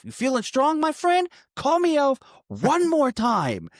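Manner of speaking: high, slow, taunting threat; a hammed-up high menacing drawl; menacing tough guy. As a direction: dare him slowly to say elf again.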